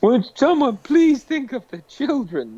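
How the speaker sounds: high, drawn-out mock plea; Reverend Lovejoy's wife. Mocking people offended on behalf of others, pleading busybody voice. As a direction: wail it like a hand-wringing moral busybody. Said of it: "it's Reverend Lovejoy's fucking wife".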